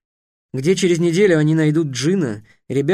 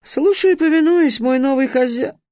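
high, loud eager servant voice; bow and offer service; genie greeting; 10 semitones higher, 3 dB louder than usual. A narrator's reading. Genie greets his new master, high and eager. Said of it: "начал он".

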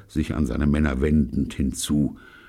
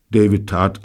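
ordinary delivery, nothing marked

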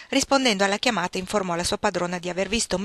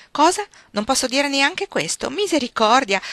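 mock outrage; 6 semitones higher, 5 dB louder than usual. A narrator's reading. Grandmother feigning shock at blushing May.

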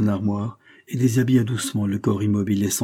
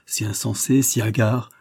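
ordinary delivery, nothing marked

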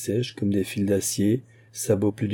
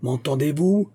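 raised, high-pitched yell; yelled question; 6 semitones higher than usual. A narrator's reading. Musketeer calling after the silent fugitive, yelled question. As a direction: call after someone who will not answer. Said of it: "hurla D'Artagnan".

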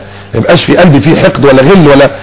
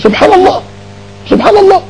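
much higher, marvelling cry; preacher marvels at human nature; awed exclamation; 4 dB quieter than usual. Real, not acted. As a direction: exclaim in wonder at God's design. Exclaiming in wonder.

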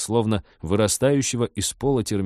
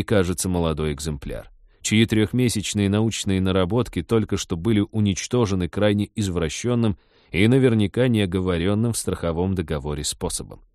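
usual speaking voice

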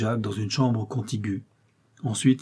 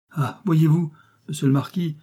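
higher, emotional farmer's voice; a touch of tearful emotion, pitched up; mild narrated sobbing; 6 semitones higher than usual. A narrator's reading. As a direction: voice lightly overcome, without real sobs. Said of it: "ajoute Landry en sanglotant".

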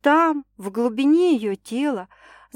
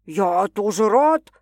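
slow, drawn-out protest; understated male indignation; indignant; 4 dB louder, slower than usual. A narrator's reading. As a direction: protest it, mildly affronted. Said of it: "возмутился Стас".

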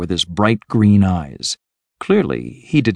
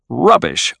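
higher-pitched, outspoken dismissal of "rubbish"; said aloud; 6 semitones higher, 3 dB louder than usual. A narrator's reading.

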